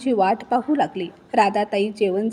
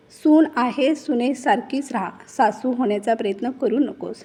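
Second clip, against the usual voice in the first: ordinary delivery, nothing marked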